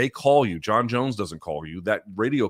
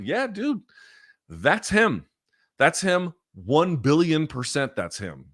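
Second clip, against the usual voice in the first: emphatic insistence; 7 semitones higher, slower than usual. Real, not acted. Insisting it was really him.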